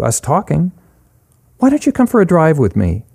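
high, lightly voiced character offering friendship; Charlie, friendly and inviting. Charlie invites Eric for a drive, in a high, friendly character voice.

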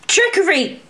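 angry cry reaching for her sword; yelled accusation. A narrator's reading. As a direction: shout the accusation. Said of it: "Alex yelled".

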